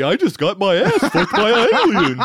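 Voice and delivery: high loud cartoon wife, laughing; Betty cartoon voice. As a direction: squeal it like a cartoon housewife. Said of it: "we're fucking good at voices".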